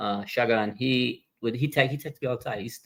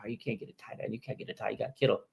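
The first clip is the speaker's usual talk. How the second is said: mocking the doubters in a much softer voice; mumbled, much softer mock of the critics; 11 dB quieter, faster than usual. Imitating fans who doubted the draft, much softer and mocking. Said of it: "people are like".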